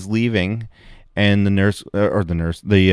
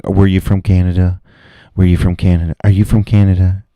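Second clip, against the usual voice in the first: louder, blunt repeated question; mock loud questioning; 5 dB louder than usual. At the bar, mock-grilling patrons on where they're from, loud. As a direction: bark it like an interrogator. Imagined asking patrons' origin.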